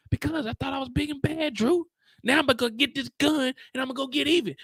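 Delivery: Diana voice. Retold or imitated. Diana telling Drew she wants revenge, high and defiant. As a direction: play Diana, fired up for revenge.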